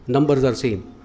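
ordinary talk, nothing marked

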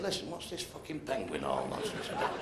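gruff mock punter. Man in brothel demands answer.

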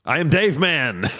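high, slow, proud declaration; mock heroic line. Co-host pretending to answer the supervisor, slow grand voice. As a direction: announce yourself grandly.

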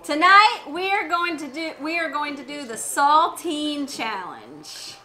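ordinary talk, nothing marked

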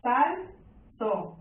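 SAL... TÓ, with a long gap between; "Saltó" broken into two separate beats; split into syllables. Shown as a demonstration. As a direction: say Saltó one syllable at a time.

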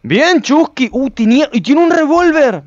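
loud, high-pitched excitement; thrilled discovery. Real, not acted. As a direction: burst out delighted at the loot. Encuentra un revólver. (emocionado, a gritos agudos)

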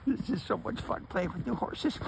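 high-pitched voice for a delighted horse; playful horse's voice. Pretend. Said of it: "He says".

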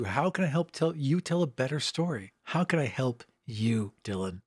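usual speaking voice

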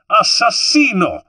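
high, loud alarm cry; a woman alerting the guests, shrieking Italian; woman's cry. A narrator's reading. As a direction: scream it across the garden. Woman's voice rang out.

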